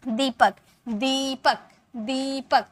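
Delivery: दीपक with the ई held long. Shown as a demonstration. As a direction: draw out the ई in दीपक. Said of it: "थोड़ा हम टान कर बोलते हैं ना". (दीपक में ई को खींचकर बोलना)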